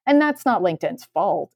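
usual speaking voice

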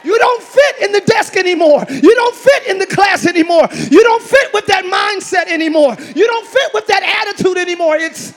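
loud, driving preacher cadence; rising sermon shout; full-force preaching. Acted. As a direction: preach it hard to the congregation.

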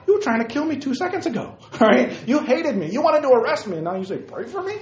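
high, indignant disbelief; acted squeaky outrage at the request; mock incredulity. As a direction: protest in a high, incredulous pitch. Acted incredulous reply to the king.

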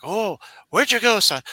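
put-on high, loud doddering old father; old man voice. Jonathan Winters doing a doddering old man for the Tonight Show. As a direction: play the feeble old dad. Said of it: "one of them would go like".

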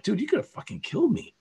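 high, quick mock-indignant protest; retold protest. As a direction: replay an incredulous complaint, fast and high. Retelling his protest to friend.

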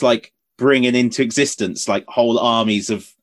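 ordinary delivery

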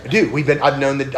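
normal talking voice

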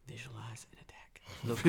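hushed whisper of a calm mentor; whispered self-coaching before a fight. Retold or imitated. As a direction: breathe it like a meditation coach. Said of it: "you just see him going".

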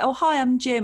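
casual friendly hello. Retold or imitated. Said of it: "he was like".

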